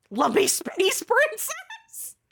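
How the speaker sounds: slow, high cartoon princess voice; hammed-up cartoon princess impression; Lumpy Space Princess. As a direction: stretch it out high, breaking into a laugh. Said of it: "That was more L S P".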